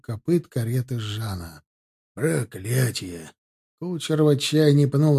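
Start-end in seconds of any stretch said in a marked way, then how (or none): 2.1-3.3 low, slow, quieter curse of a coachman; a despairing driver swears; a lightly voiced coachman in despair; coachman cursing. A narrator's reading. Coachman curses wrecked axle.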